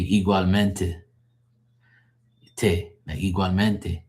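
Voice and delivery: "igualmente" with the final te pronounced; sounded last syllable. Shown as a demonstration. Showing how you guys probably say it, sounded last syllable. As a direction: sound out the "teh" closing "igualmente". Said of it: "you guys stress your last two words".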